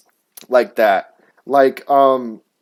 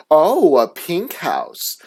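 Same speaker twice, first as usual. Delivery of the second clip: slow, stretched-out mock amazement; exaggerated teacher's fake wonder; mocking teacher imitation; 2 semitones higher, much slower than usual. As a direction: drag out a swooping, condescending "oh". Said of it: "legit says it like that".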